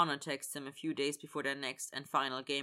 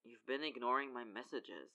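soft, subdued reproach; understated hurt, kept low-key; 3 semitones lower, 6 dB quieter than usual. A narrator's reading. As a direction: complain quietly, a touch wounded. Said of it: "because he sounds hurt".

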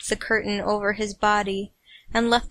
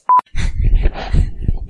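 squealing high giggle fit; high laughing fit; 6 dB louder than usual. Real, not acted. Reader cracking up before the blooper, high giggling. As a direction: break into helpless high laughter. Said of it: "I can't even start without laughing".

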